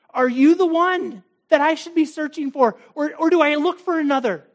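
high, loud searching cry; urgent loud plea; pleading cry. Pretend. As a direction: call out the question to God. Preacher voicing seeker's cry.

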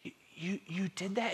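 soft, high, halting question; hurt disbelief. Acted. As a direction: falter in quiet, pained disbelief. Acting the disappointed loved one.

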